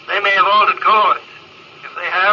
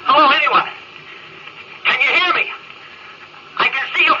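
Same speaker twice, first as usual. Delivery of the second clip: calling out; 8 semitones higher than usual. Acted. Calling out alone in space.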